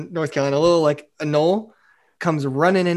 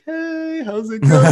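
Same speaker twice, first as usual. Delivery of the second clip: high, breezy greeting; mock nonchalant hello to the cop; overly casual; 6 semitones higher, 4 dB louder, slower than usual. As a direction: act totally innocent.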